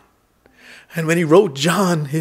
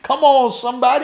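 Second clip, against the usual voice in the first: calling out; 9 semitones higher, faster than usual. Real, not acted. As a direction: rouse the congregation. Preacher calling to the congregation.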